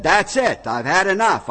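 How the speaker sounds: fed up. Acted. God fed up.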